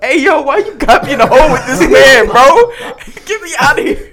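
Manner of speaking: loud high laughing panic; mock scared. Imitating scared Gerard begging to get out of the hole, loud and high. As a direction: plead to be let out, half laughing. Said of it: "Gerard's like".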